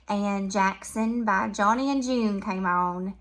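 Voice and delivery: ordinary talk, nothing marked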